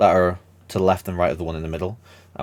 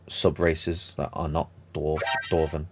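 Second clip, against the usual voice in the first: ordinary talk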